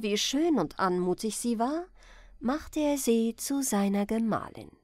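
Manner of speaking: plain reading voice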